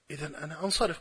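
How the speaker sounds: soft, dropped-volume quote; subdued retold reply. Retells opponent announcing he will leave.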